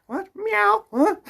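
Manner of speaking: high-pitched startled cat; silly cat voice. Acted. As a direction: squeak it like a confused cat. Improvised cat-or-fish character.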